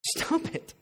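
hushed, breathy rebuke; preacher voicing Paul's appeal to Peter as a whisper; whispered stop. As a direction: whisper it, firm and close. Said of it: "that was his appeal".

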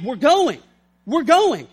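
loud, acted, determined order; forceful command. Paul pulling himself onward, in a loud determined command. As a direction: drive it home loudly, phrase by phrase.

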